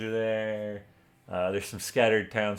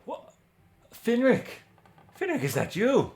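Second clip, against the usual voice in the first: put-on louder, higher joyful hail to an old friend; delighted greeting; 5 semitones higher, 5 dB louder than usual. Torn overjoyed to see Finric again. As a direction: call out his name in happy surprise.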